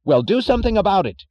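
loud, fast, high demand; cried out. Acted. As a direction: bark it at the whole crowd. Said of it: "cried Mister Thompson".

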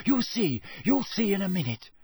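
high, eager promise; excited, secretive urging. A narrator's reading. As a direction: bubble with excitement, pitched high. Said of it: "said Hermione excitedly".

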